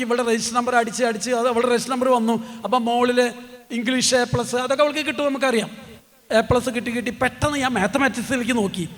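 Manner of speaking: ordinary delivery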